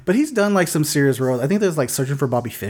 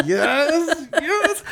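high, laughing, hammed-up actor voice; giggly high "yes, yes" like a cheeky actor; Tim Curry. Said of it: "Tim Curry is in this movie, like".

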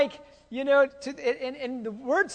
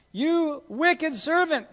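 slow, loud, high roar of rebuke; roaring king; 7 semitones higher, 5 dB louder, much slower than usual. A narrator's reading. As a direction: bellow it out, dragging each word. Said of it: "the king roared".

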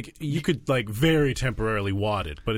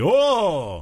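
high, drawn-out crowd "oh!"; reacting "Oh!"; 7 semitones higher, 3 dB louder than usual. Shown as a demonstration. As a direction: demonstrate the big reaction to a burn. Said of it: "the most important part is the".

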